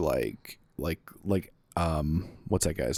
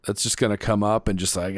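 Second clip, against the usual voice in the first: usual speaking voice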